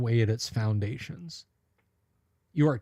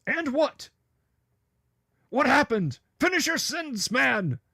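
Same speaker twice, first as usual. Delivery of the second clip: mock alarm; 9 semitones higher, 4 dB louder than usual. Mock alarm at unfinished sentence.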